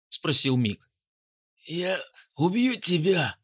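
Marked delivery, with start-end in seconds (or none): 1.6-3.4 slow, tearful, pained threat; sobbing threat. Acted. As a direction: whimper it through the pain. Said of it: "всхлипнул охранник".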